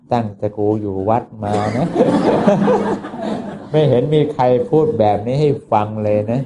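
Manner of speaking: laughing through the words; a retold monk laughing in wonder; amused laughing monk. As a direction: chuckle as you marvel at her.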